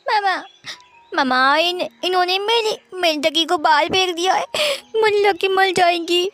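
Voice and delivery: put-on high wailing child's sob; little boy crying over his thrown-away duck. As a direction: wail over the lost toy. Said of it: "wing बहुत रो रहा था".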